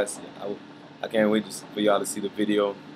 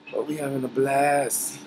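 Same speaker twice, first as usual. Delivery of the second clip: drawn-out, higher-pitched hype; excited; 5 semitones higher, slower than usual. Real, not acted. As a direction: stretch it out with excitement. Hyped exclamation to viewers.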